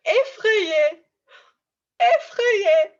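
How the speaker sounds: loud, high frightened cry; hammed-up scared squeal; acted scared. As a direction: act the word out in mock fear. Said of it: "show me the action with it".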